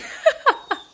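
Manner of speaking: bright high giggle; high laugh. Real, not acted. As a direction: burst of giggling. Laughs at own plan.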